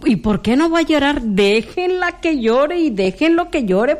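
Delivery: high, quick indignant question; indignant retort. Retold or imitated. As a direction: fire back at them, incredulous.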